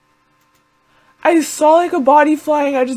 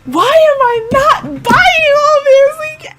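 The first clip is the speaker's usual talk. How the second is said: incredulous; 13 semitones higher, 4 dB louder than usual. Real, not acted. Reacting in disbelief at the screen.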